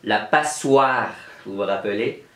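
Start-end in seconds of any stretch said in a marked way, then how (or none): none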